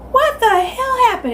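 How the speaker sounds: high, slow furious demand; angry mom. Retold or imitated. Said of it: "my mom screaming".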